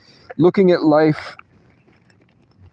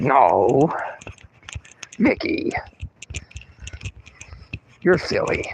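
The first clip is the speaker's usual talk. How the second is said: imitating Melissa; 2 semitones higher, 3 dB quieter, slower than usual. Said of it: "And Melissa's like".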